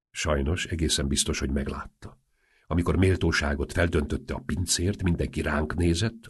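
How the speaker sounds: usual speaking voice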